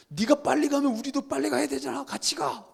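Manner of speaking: high-pitched acted protest; pleading complaint. Classmate begging friend not to go fast, high complaint. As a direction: whine after a friend who is racing ahead.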